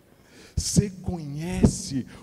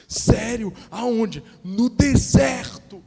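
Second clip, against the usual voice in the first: excited gossip; 8 semitones higher, 4 dB louder, slower than usual. Acted. Acted gossip dialogue.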